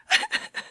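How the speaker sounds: high-pitched giggle; bright squeal of laughter. Real, not acted. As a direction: giggle at herself. Laugh after plug.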